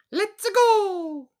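very high, loud squeaky cheer; Mario voice. Retold or imitated. Mocking the Mario voice, very high and loud. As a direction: chirp it like cartoon Mario. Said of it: "I don't need to hear".

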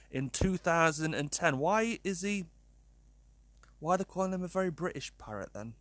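ordinary talk, nothing marked

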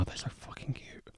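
whispered gushing over cute pictures. Real, not acted. Looking at baby bear photos, soft whisper.

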